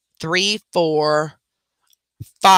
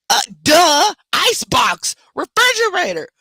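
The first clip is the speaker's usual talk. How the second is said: mock duh; 11 semitones higher, 3 dB louder than usual. Mother mocking obvious answer.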